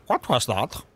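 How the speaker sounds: imitating the comic's odd kid voice, shifted away from his own; wacky kid voice. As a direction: parrot the silly child voice back. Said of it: "so that's the kid's voice you're going with".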